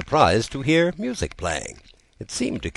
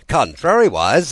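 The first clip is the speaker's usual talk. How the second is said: loud, higher shout of "Contrariwise"; shouted retort; 8 semitones higher, 8 dB louder than usual. Acted. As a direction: bellow "Contrariwise" with a rising end. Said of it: "he would only shout out".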